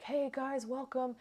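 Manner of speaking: high, bright-eyed American host voice; super American. Retold or imitated. Imitating the friendly owner greeting guests. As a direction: act out the owner's eager American welcome. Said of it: "super American".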